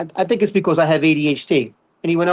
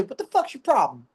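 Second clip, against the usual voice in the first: higher-pitched mock snap; snapping retort; 8 semitones higher than usual. Comic imagining snapping back at a friend, mock irritation. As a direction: play an irritated comeback without shouting. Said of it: "You just wanna snap".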